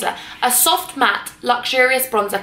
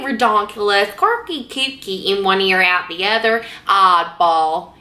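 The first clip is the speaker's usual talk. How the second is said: hammed-up Deep South drawl; Deep South accent; slower than usual. Shown as a demonstration. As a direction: put on an amateur Deep South accent, stretching the vowels. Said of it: "I used to be really good at Deep South".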